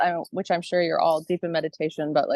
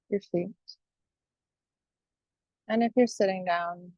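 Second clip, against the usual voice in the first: guided meditation. Acted. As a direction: guide listeners calmly, phrase by phrase, leaving space.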